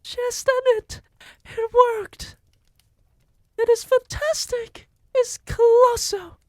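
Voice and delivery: very high, loud adoring witch voices; cartoonish high cheering; cheering witches. A narrator's reading. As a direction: fawn over the leader ecstatically. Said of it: "yelled the audience".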